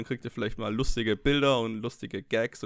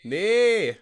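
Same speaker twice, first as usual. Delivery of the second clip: shouted reply; 8 semitones higher, 4 dB louder than usual. Pretend. Pretend Gottschalk shouting back.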